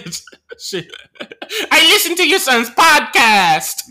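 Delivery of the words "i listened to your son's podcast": put-on high, loud, drawn-out Filipino accent; slow Filipino accent impression, pitched high. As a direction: do a hammed-up Filipino lady's accent. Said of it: "so I can do the accent though".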